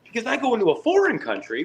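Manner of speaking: mock indignant. Retold argument at border.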